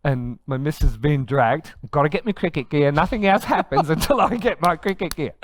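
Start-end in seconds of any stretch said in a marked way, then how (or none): 1.9-5.3 high, loud, comically stubborn demand; mock insistence. Dad dragging his family out for cricket gear, mock insistent. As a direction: insist loudly, half laughing at yourself.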